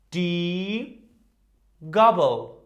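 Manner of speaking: Gabel said GAH-bel, stressed first syllable; model word. Shown as a demonstration. Teacher modelling Gabel for repetition, loud and clear.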